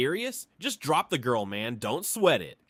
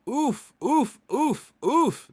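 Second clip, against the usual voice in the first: high pained oof oof oof, a little louder; shocked oofs; 10 semitones higher, 3 dB louder, much slower than usual. Acted. Friend on the phone hearing the dowry, pained high oofs. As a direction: wince out each oof in disbelief.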